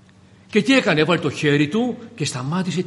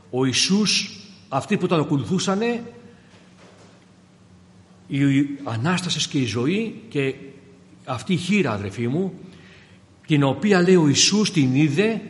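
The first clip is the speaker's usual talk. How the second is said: usual speaking voice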